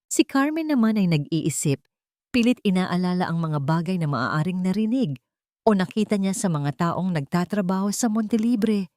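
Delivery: usual speaking voice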